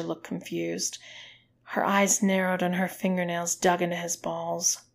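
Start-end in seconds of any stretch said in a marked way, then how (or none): none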